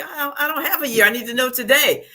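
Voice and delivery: high, fast, insistent; quoting her anxious reply to the manager, high and fast; urgent protest. Retold or imitated. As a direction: push back as if time is running out.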